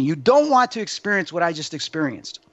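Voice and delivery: normal talking voice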